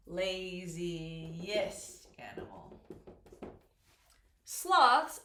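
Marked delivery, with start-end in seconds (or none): 0.1-2.8 very slow, low, word stretched while spelling; drawn out slowly. Shown as a demonstration. Teacher building the superlative for students, drawn out slowly. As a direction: stretch LAZIEST out syllable by syllable, lower. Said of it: "go through them slowly".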